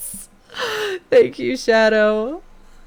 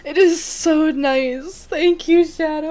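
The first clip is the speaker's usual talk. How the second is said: tearful; 6 semitones higher, 3 dB louder, slower than usual. Real, not acted.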